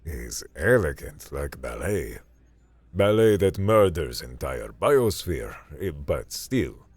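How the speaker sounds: put-on heavy Russian accent, admiring; Russian accent, savoring each word; thick Russian accent. A narrator's reading. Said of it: "spoke with a thick Russian accent".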